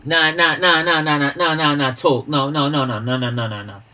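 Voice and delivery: real heated protest, easing towards the end; emphatic objection. Real, not acted. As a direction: shout down a football opinion, then tail off. Heated football disagreement with chatter.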